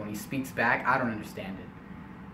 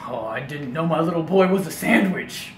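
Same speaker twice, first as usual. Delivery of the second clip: louder, mildly amazed dad voice; surprised dad; 2 semitones higher, 5 dB louder than usual. Retold or imitated. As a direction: marvel at a silly discovery. Acted dad misunderstanding his son.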